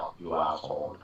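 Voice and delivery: hushed imitation of an Austrian accent; nearly whispered line in an Austrian accent; Arnie. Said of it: "in a perfect Arnie accent".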